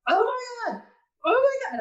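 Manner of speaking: high, teary cry of disbelief; overwhelmed teary exclamation, pitched high. Retold or imitated. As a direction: let the voice crack with tears of shock. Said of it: "I'm crying and I'm laughing".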